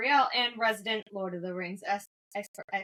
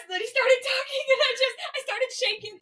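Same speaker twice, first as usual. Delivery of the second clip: giggly explanation; 14 semitones higher, 4 dB louder than usual. Real, not acted. Giggling apology after laughing mid-take.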